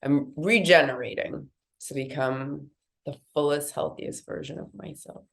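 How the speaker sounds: nasal, blocked-nose voice; talking through a stuffy nose. Real, not acted. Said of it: "if you couldn't tell with my nasalization voice".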